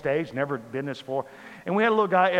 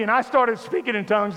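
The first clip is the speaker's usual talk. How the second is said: usual speaking voice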